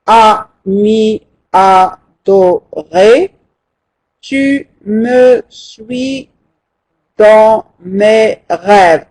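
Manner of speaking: ami adoré, tu me suis dans mes rêves said slowly, syllable by syllable; syllable count. Shown as a demonstration. Teacher checking the syllables of the new verse, slow. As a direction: count each beat of the line slowly. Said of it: "Je vais voir combien nous avons".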